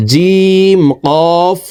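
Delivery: জিম কফ drawn out very slowly, chanting each letter name; slow Qur'an tutor spelling; slow spelled recitation. Shown as a demonstration. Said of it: "কফ হরফকে ... ধাক্কা দিয়ে উচ্চারণ".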